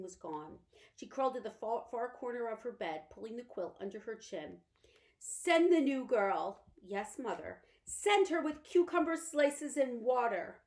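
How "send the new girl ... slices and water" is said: slow, higher and louder orders; a lady issuing demands; commanding invalid mother. Acted. Mother gives curt orders.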